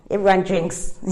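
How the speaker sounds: bursting into a real laugh mid-sentence. Real, not acted. Speaker laughing at her family's drinking habits, loud laughter.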